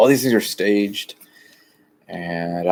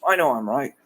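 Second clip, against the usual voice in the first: mock self-righteous quote, starting high; imitating a know-it-all insisting he's right; smug insistence; 8 semitones higher than usual. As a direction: insist you're right, pleased with yourself. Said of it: "and it's like".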